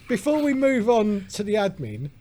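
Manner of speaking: keen to drink, raising the voice; eager. Real, not acted. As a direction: push in eagerly for the beer. Eager to get to his beer.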